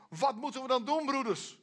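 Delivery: high-pitched, pressing appeal; urgent pleading question. Acted. Deeply struck listeners ask Peter and the apostles, pleading.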